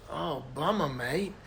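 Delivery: soft, laid-back Aussie sympathy; Australian coast guard. Acted. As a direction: commiserate quietly in a put-on Australian accent. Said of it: "Coast Guard says".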